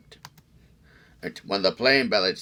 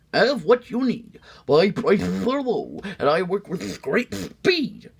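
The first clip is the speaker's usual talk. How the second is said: smooth salesman; 13 semitones higher, 5 dB louder than usual. Acted. McBean pitching his fix to the sneetches, high salesman voice. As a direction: sell it like a fast-talking fix-it man. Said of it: "in a voice clear and clean".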